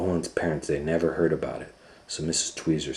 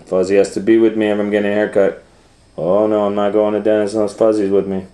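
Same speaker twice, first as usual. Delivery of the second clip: put-on fast, loud protesting kid; hint of a whining child; whiny child; 4 semitones higher, 8 dB louder, faster than usual. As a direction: rush the protest like a pouty boy. Child whining about haircut.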